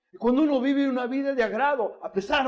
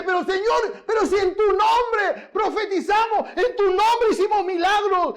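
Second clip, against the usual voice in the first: high, loud, slow desperate appeal; pleading protest; 12 semitones higher, 6 dB louder, slower than usual. Retold or imitated. False believers before God on judgment day, high desperate plea. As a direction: cry out, begging to be recognized.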